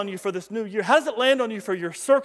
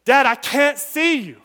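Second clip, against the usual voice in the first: raised, drawn-out call down to Dad; son crying out; 5 semitones higher, 7 dB louder, slower than usual. Retold or imitated. Son calls to his father down in the hole, crying out. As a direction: cry out slowly, higher and louder. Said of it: "the son cries out and says".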